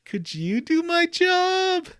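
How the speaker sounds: high, slow, put-on sheepish voice; sheepish request. Retelling the game, he voices a timid high plea. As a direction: ask it like a kid dodging chores. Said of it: "And he's like".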